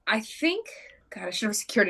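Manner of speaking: breathy whispered aside. Acted. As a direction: whisper it to yourself.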